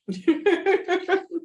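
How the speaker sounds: high, loud open laugh; a real laugh of recognition. Real, not acted. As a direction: let the laugh burst out. Laughs after confession.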